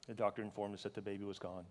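low, soft, heavy telling; quiet grief. Real, not acted. Father recalling the doctor's news of losing the baby, quiet grief. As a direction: say it softly, as a painful memory.

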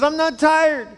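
put-on high little boy's voice protesting from bed; whiny kid. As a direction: play the stubborn four-year-old. Child answers dad in joke.

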